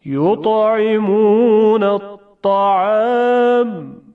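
slow, drawn-out recitation, heavy emphatic ط; Quranic recitation of examples. Shown as a demonstration. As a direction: recite each word slowly with a thick ط. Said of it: "حالا چند تا مثال".

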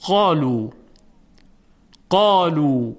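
قالو with the ا full and thick; heavy alif. Shown as a demonstration. Teacher showing the heavy قالو. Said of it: "مثال دیگر برای حرف قاف".

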